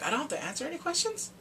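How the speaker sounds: high-pitched, put-on questioning voice; mock incredulous question. Client repeating the lawyer's advice, high questioning voice. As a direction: lift it high, as if checking in disbelief.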